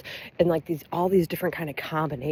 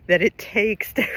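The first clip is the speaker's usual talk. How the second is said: slow words through real laughter; fighting giggles mid-sentence; laughing; 4 semitones higher, 4 dB louder than usual. Real, not acted. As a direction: push the words out while cracking up. Cracking up over the topic.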